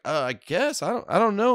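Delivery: lightly voiced flustered cashier; hesitant cashier. Teenage cashier answering an angry customer, hesitant.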